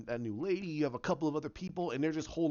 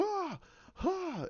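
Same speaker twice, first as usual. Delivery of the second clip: imitated training yells. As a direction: mimic students' practice shouts, thin and high. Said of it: "and they go".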